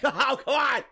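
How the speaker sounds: high-pitched, incredulous groan of protest; exasperated protest. Retold or imitated. Acted reaction to second low offer.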